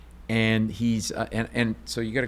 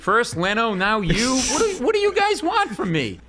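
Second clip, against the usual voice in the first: mock diva exasperation; 10 semitones higher, 4 dB louder than usual. Mock diva reaction to [name].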